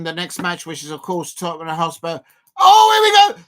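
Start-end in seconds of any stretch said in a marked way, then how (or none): 2.6-3.4 excited shout. Real, not acted. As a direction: cry out much higher and louder. Big chance in the match.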